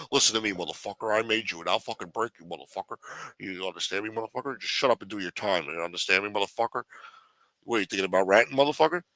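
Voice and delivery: low, fast menacing growl at the underling; a hint of a snarling mob boss; lightly voiced mob boss. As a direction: threaten him like an old mafia don. Voicing the mob boss threatening.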